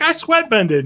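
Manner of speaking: high, chirpy boast; mock proud. Katara boasting to Hama, high and chirpy. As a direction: squeak it out, pleased with herself.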